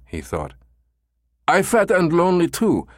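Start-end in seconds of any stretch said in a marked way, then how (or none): 1.5-2.9 put-on very high, pleading German-accented voice. Caller pretends to want an escort, high German accent. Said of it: "in his German accent".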